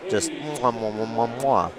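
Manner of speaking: a string of smacking air kisses; kissing noise. Shown as a demonstration. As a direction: blow quick kissing noises, one after another. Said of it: "That's my kissing noise".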